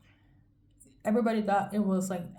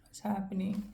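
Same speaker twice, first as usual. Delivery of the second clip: whispering; 5 dB quieter than usual. Real, not acted. Reacting to a card, whispered.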